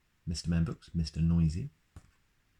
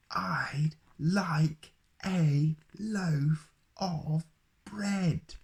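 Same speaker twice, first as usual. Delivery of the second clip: much higher, very slow drawn-out roar; roaring Mister Noisy; 11 semitones higher, much slower than usual. Acted. Mister Noisy roars at the baker who pretends not to hear, slow roar. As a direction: stretch every word into a roar. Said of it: "roared Mister Noisy".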